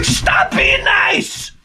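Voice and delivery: high, slow drawn-out yell; exasperated shout. Retold or imitated. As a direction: bellow it in mock despair.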